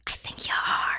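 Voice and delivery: hushed teasing whisper. Pretend. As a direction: whisper it ominously. Whispered accusation.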